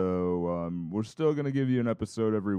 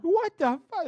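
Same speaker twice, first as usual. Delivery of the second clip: put-on old lady voice; 4 dB louder than usual. Grandma quote repeated.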